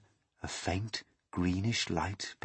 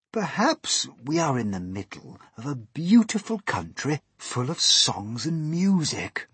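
lightly voiced cheerful grasshopper, higher; higher, lilting old grasshopper voice; 6 semitones higher, 4 dB louder than usual. A narrator's reading. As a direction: offer a hopeful dream.

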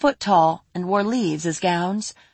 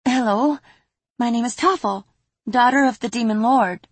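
put-on young girl voice; 6 semitones higher than usual. Toffle voiced in story.

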